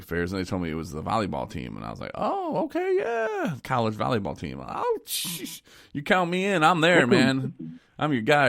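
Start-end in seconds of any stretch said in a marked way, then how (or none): 2.1-8.5 high-pitched mock enthusiasm, drawn-out oohs; suddenly all in, playing up the eagerness; eager reenactment. DJ retelling how he agreed to host the volleyball team, hammed-up eagerness.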